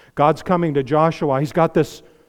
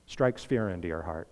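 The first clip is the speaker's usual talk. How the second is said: hushed warning; 10 semitones lower, 10 dB quieter than usual. Acted. Solemn warning to congregation.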